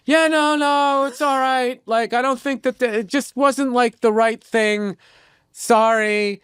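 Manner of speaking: high, drawn-out apologetic voice; imitated apologizing woman. Woman apologizing to the comic after his set, imitated apologetic voice. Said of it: "And she was apologizing".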